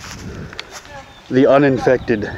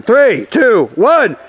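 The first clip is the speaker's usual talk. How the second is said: called-out countdown; 8 semitones higher, much slower than usual. Real, not acted. Counting down game start.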